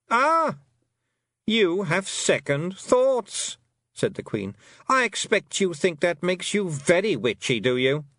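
shrill high scorn, loud on Stole; incredulous queen. A narrator's reading. A fairy queen scoffing at the girl, loud and high. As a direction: scoff at the very idea.

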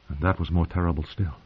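plain reading voice

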